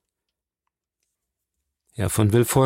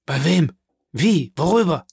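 frantic questions; 5 semitones higher than usual. A narrator's reading.

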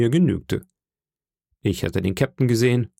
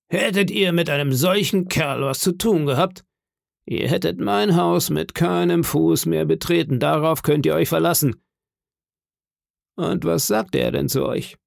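higher-pitched stern voice; Silver, threatening landlord; 6 semitones higher than usual. Acted. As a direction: lay down the law, then question.

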